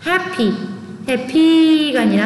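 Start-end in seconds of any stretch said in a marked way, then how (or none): 0.1-0.5 "happy" stressed on HAP, higher pitch there; first-syllable stress. Shown as a demonstration. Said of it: "액센트가 첫 번째에". ("happy"의 첫 음절에 강세)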